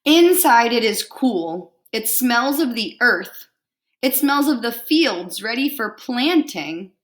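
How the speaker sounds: lively picture-book read-aloud; reading to children. Acted. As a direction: read it out warmly to a class.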